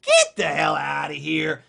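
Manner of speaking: slow, loud, scoffing dismissal; scoffing disbelief. Retold or imitated. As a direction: brush the idea off as nonsense, drawn out. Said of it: "And you might think".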